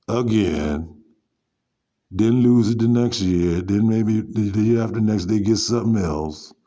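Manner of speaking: loud, fed-up ranting at full volume; heated rant. Real, not acted. Ranting loudly to viewers about flashy spending. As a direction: preach it loud like you've had enough.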